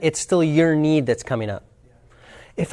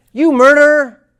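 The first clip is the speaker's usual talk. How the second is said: furious yell; 12 semitones higher, 16 dB louder than usual. Retold or imitated. Man in the audience yelling at Marshall, full furious yell. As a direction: shout it high and loud as an accusation. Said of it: "and yells".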